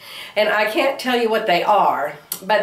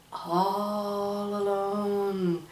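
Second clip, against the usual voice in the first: very slow, much softer alone; drawn-out whisper; 10 dB quieter, much slower than usual. Shown as a demonstration. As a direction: stretch all alone out softly. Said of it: "Do you hear the sound of".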